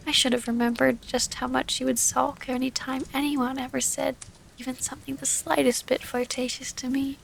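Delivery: ordinary delivery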